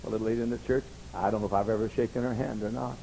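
ordinary talk

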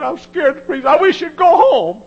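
high, loud frightened plea; scared young preacher. Retold or imitated. Preacher voicing a young preacher who is scared to preach, high and frightened. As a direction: beg like a terrified young preacher. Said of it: "He was scared to death".

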